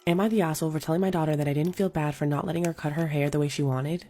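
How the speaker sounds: ordinary delivery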